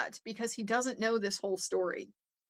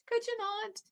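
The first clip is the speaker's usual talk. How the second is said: much higher, softer mocking repeat; mock high plea; 12 semitones higher, 4 dB quieter than usual. The attorneys begging her to stop, in a mock high plea. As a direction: jump way up and plead in mockery.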